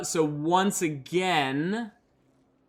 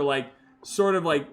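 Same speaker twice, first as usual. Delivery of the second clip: ordinary delivery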